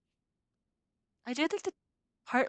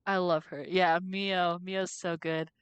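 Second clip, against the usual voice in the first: ordinary talk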